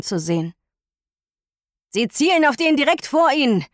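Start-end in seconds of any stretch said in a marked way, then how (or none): 1.9-3.6 raised urgent warning; shouting over radio. Acted. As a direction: yell the warning through the radio. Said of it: "Heukür schreit uns an".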